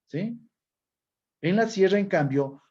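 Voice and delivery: ordinary talk, nothing marked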